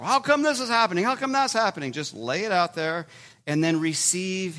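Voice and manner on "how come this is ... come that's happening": anguished plea. Retold or imitated. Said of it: "with David, like".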